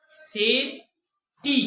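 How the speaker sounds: Safety broken in two, long pause between सेफ and टी; very slow demo, one beat per syllable. Shown as a demonstration. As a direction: split it slowly as "सेफ ... टी". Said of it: "जब आप इसे पढ़ें इस तरह".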